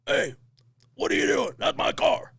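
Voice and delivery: retold yell, pitched high and loud; hammed-up shout from a big guy; mock shout. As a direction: shout like the angry owner catching them. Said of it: "big, deep voice like".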